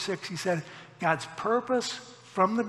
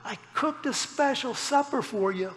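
higher-pitched imitation of a tearful wife; lightly hammed-up teary complaint; mock tearful wife; 5 semitones higher than usual. Young wife telling her husband about ruined supper, mock tearful. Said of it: "she, through her tears".

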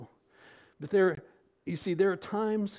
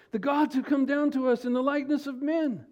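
loud, high cry of a crowd; lifted-up voice; 10 semitones higher, 5 dB louder than usual. A narrator's reading. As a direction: proclaim it loudly, pitched well above normal. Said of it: "they lifted up their voices".

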